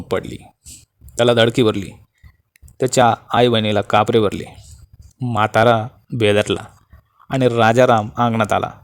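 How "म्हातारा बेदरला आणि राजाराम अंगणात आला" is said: plain reading voice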